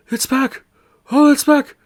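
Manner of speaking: high, loud joyful squeal; ecstatic shriek. Acted. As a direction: shriek with joy at getting it back. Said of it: "someone go".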